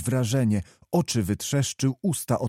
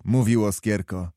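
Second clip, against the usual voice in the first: usual speaking voice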